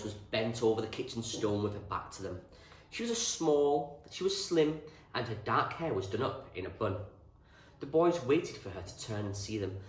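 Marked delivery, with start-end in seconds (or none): none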